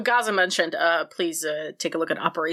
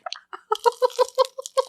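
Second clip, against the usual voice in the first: nervous giggle. Real, not acted. Nervous laugh before spicy bite.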